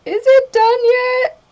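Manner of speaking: high, loud mock whine; whiny is it done yet. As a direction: whine it like an impatient kid. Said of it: "me being like".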